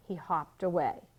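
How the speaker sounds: ordinary delivery, nothing marked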